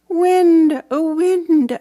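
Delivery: high, loud call up to the treetop. Acted. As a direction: call the wind to come back. Child calling to wind.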